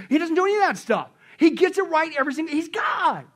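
high, loud, emphatic preacher; full-force preaching. Real, not acted.

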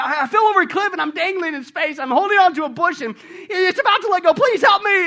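loud, high, frantic plea; panicked tourist. Acted. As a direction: beg while dangling over a cliff. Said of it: "And the guy said".

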